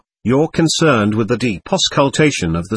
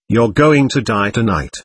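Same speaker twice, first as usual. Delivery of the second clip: ordinary delivery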